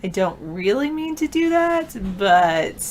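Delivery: high, sing-song classroom delivery; talking to students. Real, not acted. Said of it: "talk like I'm talking to students".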